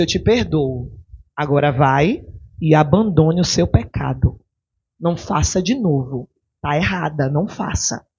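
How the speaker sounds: forgive her gently but firmly; a touch of tender firmness from Jesus; gentle forgiveness. Retold or imitated. Jesus speaking gently to woman.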